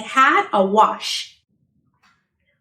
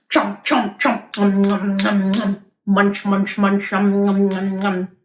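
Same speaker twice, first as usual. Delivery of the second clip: low chomp and nom sounds; munching noises; 5 semitones lower, slower than usual. Pretend. Truck eating bolts, low munching noises. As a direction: chew noisily.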